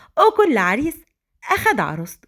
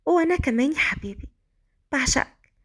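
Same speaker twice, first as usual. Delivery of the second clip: Malika, bashful; 3 semitones higher, 3 dB quieter than usual. Acted. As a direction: answer her husband sweetly and shyly. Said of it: "ردت مليكة بكسوف وقالت".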